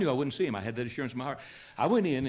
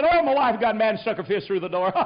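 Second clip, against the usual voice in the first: high, loud voice full of laughter; laughing retelling; 7 semitones higher, 6 dB louder than usual. Retold or imitated. Young minister explaining the hole in the door, laughing. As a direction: imitate him laughing as he explains. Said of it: "He laughed".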